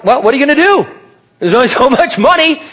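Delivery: high-pitched, comic helplessness; carefree shrug. Retold or imitated. New believer facing foreclosure, comically unbothered.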